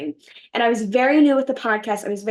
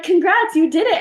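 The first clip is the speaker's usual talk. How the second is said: excited congrats; 9 semitones higher, 7 dB louder than usual. Retold or imitated. Organizers announce her acceptance.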